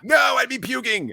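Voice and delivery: high, loud protest; emphatic refusal. Real, not acted. Host refusing the gross meal, in a high emphatic cry. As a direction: blurt it out, pitch leaping up.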